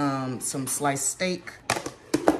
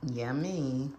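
ordinary talk, nothing marked

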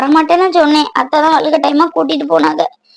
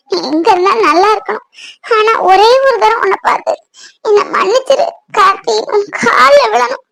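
higher-pitched crying voice; tearful apology; 5 semitones higher than usual. Acted. Woman addressing absent Karthik, begging forgiveness, tearful apology.

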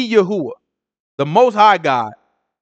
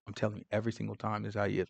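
hushed fast aside; 8 semitones lower, 20 dB quieter, much faster than usual. Retold or imitated. Ahab muttering to Jehoshaphat.

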